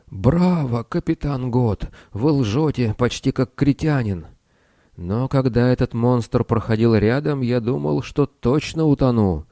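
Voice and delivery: high, odd little wizard. A narrator's reading. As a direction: chirp like a tiny old wizard. Snish voiced by narrator.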